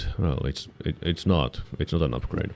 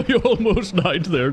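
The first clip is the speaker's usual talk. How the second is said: high laughing relief; relieved laughter; 11 semitones higher than usual. Real, not acted. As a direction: laugh it off, shaken. Laughing after near death.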